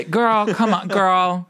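put-on high sassy Girl come on. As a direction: scold her like a diva friend. Mock scolding a celebrity.

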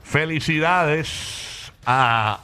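very slow, stretched-out congratulations; milk every word like a ceremonial announcement; hammed-up proclamation, word by long word; drawn-out mock announcement. Mock ceremonial running congratulation bit.

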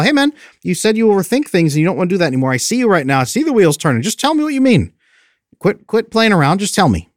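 calling someone out. Retold or imitated. Acted friend calling speaker out.

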